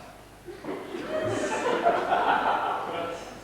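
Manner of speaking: high, breathy burst of laughter. Acted. Caller laughing down the phone, high laugh. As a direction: let out a long, high laugh.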